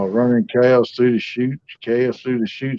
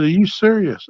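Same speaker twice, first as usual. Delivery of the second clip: retold disbelief, pitched high; surprised mother; 8 semitones higher than usual. Mother hearing her son's offer of daily calls, surprised. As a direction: imitate a mother's astonished question.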